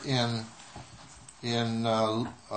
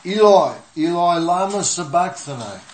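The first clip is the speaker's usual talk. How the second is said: loud, raised cry, falling at the end; Jesus crying out; 3 semitones higher, 6 dB louder than usual. A narrator's reading. Preacher reading Christ's cry from the cross, loud cry. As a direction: call it out loudly from scripture. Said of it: "Jesus cried out in a loud voice".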